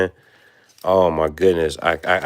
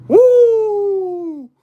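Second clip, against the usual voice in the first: long, high, loud woo; celebratory whoop; 6 dB louder than usual. Real, not acted. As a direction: let out a piercing victory whoop. Celebrating a card pull.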